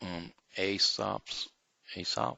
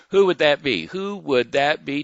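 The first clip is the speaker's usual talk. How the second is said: quiz teaser; 7 semitones higher, 10 dB louder than usual. Acted. Quiz host asking audience.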